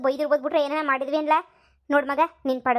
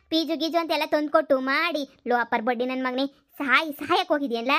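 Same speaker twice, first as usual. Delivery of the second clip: ordinary talk, nothing marked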